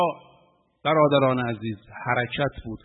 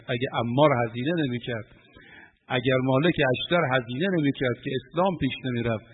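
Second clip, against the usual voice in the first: usual speaking voice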